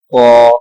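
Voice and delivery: قا said deep and full from the back; thick qa. Shown as a demonstration. Teacher contrasts the thick قا with the thin تا. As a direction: make قا heavy and full. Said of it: "قا موٹا پڑھا جائے گا".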